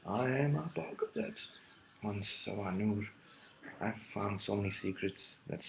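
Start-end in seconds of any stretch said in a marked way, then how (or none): 0.1-1.5 a hint of a grand villain, slow and higher; slow, mildly theatrical self-declaration; lightly voiced villain proclamation; Apocalypse. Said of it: "so is Apocalypse".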